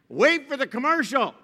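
high, loud husband imitation; dismissive husband. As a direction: brush her off lightly. Retold husband's line.

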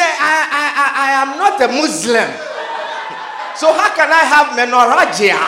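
loud, fast, stammering protest through laughter; hammed-up indignant patient; laughing mock outrage. Patient protests to the doctor, laughing and loud.

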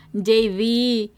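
ordinary talk

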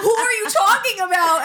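high, loud mock exasperation; exasperated. Imitating cousin baffled by the nickname, exasperated.

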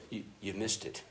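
ordinary talk

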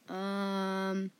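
long, level, sheepish hum; hesitant and sheepish. A narrator's reading. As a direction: stall sheepishly on a drawn-out sound. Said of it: "they both said sheepishly".

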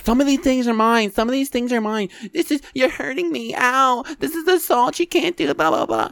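high, squealing mock victim; high mock-wounded whine; whiny shoplifter. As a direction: squeal it like a caught thief playing hurt. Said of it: "she goes".

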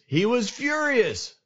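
high, drawn-out outburst on the anger; dramatized fury. A narrator's reading. Preacher telling how David heard all of this, hammed-up fury. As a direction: blow up theatrically, stretching the words.

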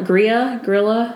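ordinary talk, nothing marked

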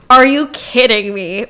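slow, stunned disbelief; drawn-out shock. Retold or imitated. Friend's shocked reaction retold.

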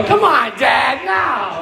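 put-on a mortified teen yelling at his dad to get out; drawn-out embarrassed teenage yell. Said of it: "It was funny when he was embarrassed".

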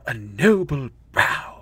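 slow, lightly pompous self-admiration; vain Sixth Doctor. Retold or imitated. Sixth Doctor admiring himself in the mirror, slow and lightly pompous. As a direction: savour it like admiring yourself. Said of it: "he looks at himself in the mirror and he goes".

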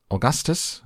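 high-pitched call, lightly shouted; alarmed cry. A narrator's reading. Factory owner calling boy off his chocolate, high alarmed cry. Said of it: "cried Mister Wonka".

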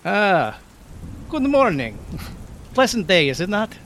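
high, breezy mock-cheer; bright fake cheer in the storm; jaunty greeting. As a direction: Dante greets Weiler with ironic cheer.

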